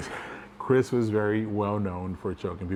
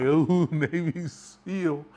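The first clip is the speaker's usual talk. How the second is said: high, drawn-out "ooh" with a laugh; slow sing-song tease through laughter; mocking taunt; 8 semitones higher, 4 dB louder, much slower than usual. Choked man still making fun of his buddy, mocking.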